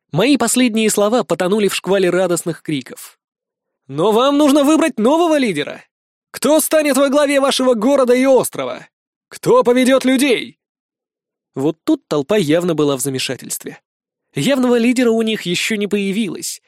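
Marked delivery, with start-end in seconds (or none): 3.9-10.6 high, ringing challenge to a crowd; pitched-up public oratory; rousing leader's speech. Acted. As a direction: throw the questions out to the crowd.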